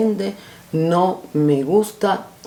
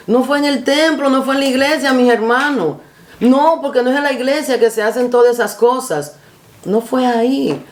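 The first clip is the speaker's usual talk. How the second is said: preaching; 6 semitones higher than usual. Acted. Preaching at full force.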